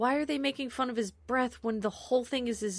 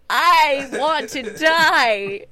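mock despair; 6 semitones higher, 12 dB louder, much slower than usual. Mock despair at fart scene.

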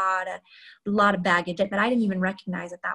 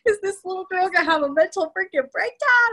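nervous seatmate; 11 semitones higher, 4 dB louder than usual. Retold or imitated. Imagined thoughts of seatmate.

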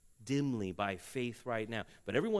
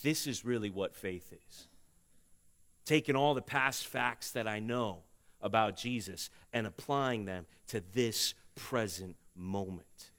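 ordinary talk, nothing marked

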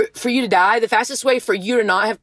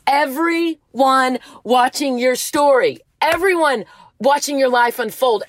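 passionate exhortation, pitch climbing toward the end; 3 semitones higher than usual. Real, not acted. Urging struggling listeners to go through it, passionate. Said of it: "Sorry, I'm getting passionate here".

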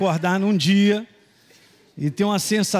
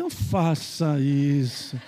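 slow warning; 6 semitones lower, much slower than usual. Retold or imitated. His warning to girlfriend.

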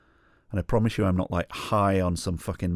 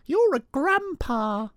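put-on very high, slow little-kid voice; small kid's voice; slower than usual. A small boy telling a stranger he is old, high and slow. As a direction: squeak it out slowly like a five-year-old.

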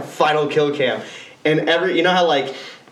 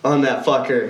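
normal talking voice

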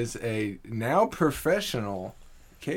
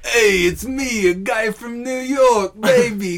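hammed-up New York guy, high and loud; a mock tough-guy New York accent, laughing through it; 12 semitones higher, 11 dB louder than usual. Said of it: "Can you?".

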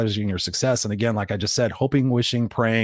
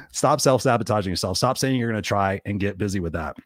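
ordinary talk, nothing marked

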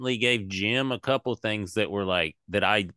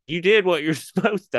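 laughing reassurance; 8 semitones higher, 5 dB louder than usual. Retold or imitated. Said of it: "I was like".

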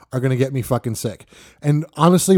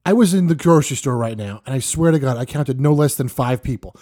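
usual speaking voice

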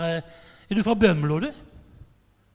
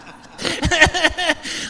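high, rapid laughter; real high-pitched belly laugh; hearty laugh; 10 semitones higher, 5 dB louder than usual. Real, not acted. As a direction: crack up at your own joke. Laughs at his own joke.

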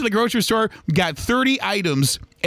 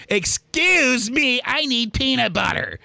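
slow, higher, pointed "excuse me"; impatient lady; 6 semitones higher, much slower than usual. Retold or imitated. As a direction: drag it out like an annoyed shopper. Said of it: "she goes".

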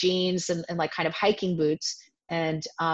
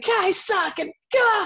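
high-pitched mock wail; much higher, self-pitying outburst; acted-out crisis; 14 semitones higher, 3 dB louder than usual. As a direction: wail it in hammed-up despair. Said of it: "I was having a crisis".

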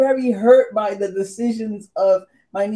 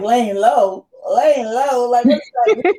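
incredulous; 5 semitones higher, 4 dB louder, slower than usual. Retold or imitated. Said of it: "I'm like".

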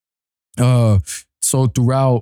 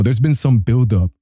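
ordinary delivery

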